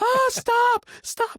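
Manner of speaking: very high, loud mock shriek; hammed-up falsetto scream for help; imitated screaming. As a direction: squeal like a panicking little girl. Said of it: "she's running around screaming".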